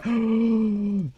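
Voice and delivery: quick breathless gasp; sharp gasp. Real, not acted. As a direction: gasp in surprise. Sudden discovery while playing.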